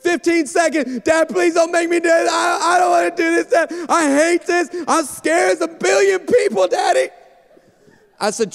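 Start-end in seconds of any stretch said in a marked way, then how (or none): 0.0-1.0 countdown call. Retold or imitated. Producer countdown. 1.0-7.1 higher, louder, half-sobbing shout of a scared daughter; panicked crying plea. Retold or imitated. Daughter crying out to her dad seconds before going live, panicked.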